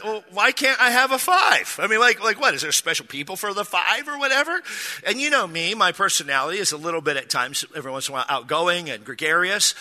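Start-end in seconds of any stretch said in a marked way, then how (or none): 0.0-4.6 high-pitched, indignant comic pushback; retold mock indignation; indignant protest. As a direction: challenge it, pitched well up, incredulous.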